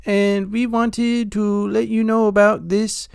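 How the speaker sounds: high, loud mock Indian telemarketer accent. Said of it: "eight different accents".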